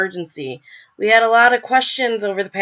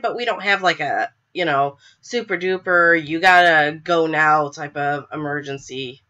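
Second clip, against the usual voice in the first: ordinary delivery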